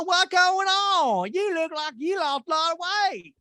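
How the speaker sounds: squeaky high put-on gossip voice; lifted mocking falsetto. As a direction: coo it up high and slow like a catty friend. Mock voice of cast member.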